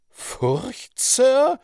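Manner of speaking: high, loud indignant question; flaring up. A narrator's reading. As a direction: flare up, leaping in pitch on the last word. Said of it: "rief Madame heftig auffahrend". (heftig auffahrend, hoch und laut)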